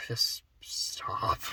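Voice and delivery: soft whispered plea. Real, not acted. Telling the character to stop talking, whispering. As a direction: whisper it, pleading.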